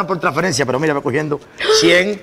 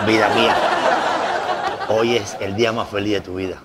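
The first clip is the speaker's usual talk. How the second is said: put-on Galician Spanish accent; 5 semitones lower than usual. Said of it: "acento gallego ridículo ese". (acento gallego, presumiendo entre risas)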